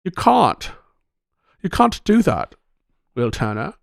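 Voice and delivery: half-hearted film-character plea; Keira Knightley impression. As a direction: mocks a Pirates scene in a weak Knightley voice. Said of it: "Keira Knightley".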